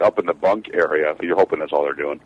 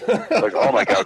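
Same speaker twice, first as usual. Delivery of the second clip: high laughing oh my God; laughing disbelief; slower than usual. Real, not acted. Laughing at the old teacher's demand, much higher.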